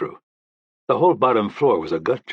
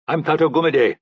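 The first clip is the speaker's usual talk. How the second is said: put-on friendly, raised voice with an African accent; pleasant African accent; 8 semitones higher, 4 dB louder than usual. A narrator's reading. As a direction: greet warmly in an African accent. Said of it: "through a pleasant African accent".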